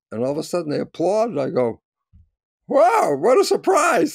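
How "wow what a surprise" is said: high, loud, drawn-out amazement; retold amazement, pitched way up; astonished. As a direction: exclaim it slowly in wide-eyed wonder. Reliving astonishment at unexpected applause.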